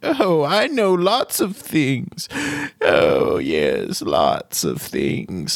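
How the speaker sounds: put-on high, slow, creaky old gnome wizard, cheerfully cryptic. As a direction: play a quirky ancient gnome teasing a newcomer.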